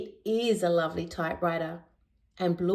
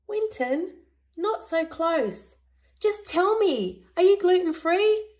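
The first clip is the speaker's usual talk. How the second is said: terrier backing off; 10 semitones higher than usual. A narrator's reading. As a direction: push him away, then demand the answer. Terrier annoyed by close Winton.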